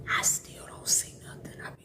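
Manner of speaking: breathy unvoiced whisper. Whispering to herself during a reading. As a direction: whisper it like a secret aside.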